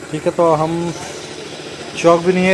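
ordinary delivery

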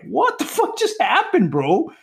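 very high, drawn-out astonishment; stunned disbelief. Retold or imitated. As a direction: squeak it out slowly, utterly baffled. Retelling shock at seeing the bust.

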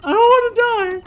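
panicked, very high-pitched squealing; hammed-up terror, squeaking way up high; scared squeal. Real, not acted. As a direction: climb into a tiny, terrified falsetto whine. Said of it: "I'm so scared".

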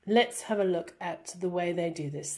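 normal talking voice

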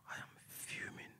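unvoiced whisper, seething. Real, not acted. Telling the audience how angry he was, a seething whisper. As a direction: hiss it under your breath.

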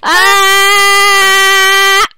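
high, loud yell into a long scream; panicked game scream. Pretend. Players caught in the game's fire, mock-panicked scream. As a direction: play up burning alive.

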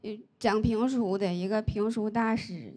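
normal talking voice

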